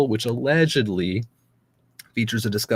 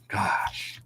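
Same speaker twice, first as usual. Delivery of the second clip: whispered gosh; 5 dB quieter than usual. Real, not acted. Dismay at thousands of escaped prisoners.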